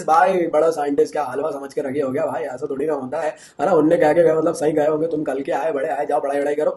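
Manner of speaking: lightly voiced elder brushing off an upstart; dismissive elder scientist. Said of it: "जो बुजुर्ग scientist थे".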